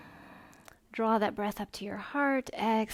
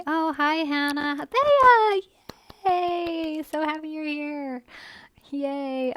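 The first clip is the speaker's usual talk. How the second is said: delighted, high, louder hello; excited greeting; 5 semitones higher, 7 dB louder, slower than usual. Real, not acted. Yoga teacher greets people joining her online class, excited. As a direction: cheer their arrival in a high, drawn-out call.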